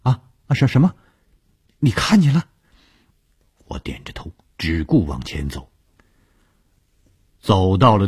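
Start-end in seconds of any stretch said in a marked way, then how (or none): 0.0-2.5 high, loud, stammered startled questions; tense, high-pitched alarm; nervous questioning. A narrator's reading. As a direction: blurt it nervously, tripping on the first words. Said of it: "只是紧张地问".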